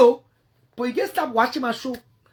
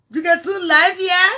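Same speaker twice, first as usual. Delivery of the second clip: mocking taunt, higher; 6 semitones higher, 7 dB louder, slower than usual. Real, not acted. Taunting rival on live video.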